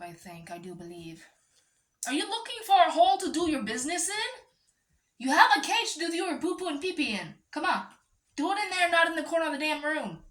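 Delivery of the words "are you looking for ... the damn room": higher, louder, fast pet-scolding voice; exasperated owner scolding her ferret. Real, not acted.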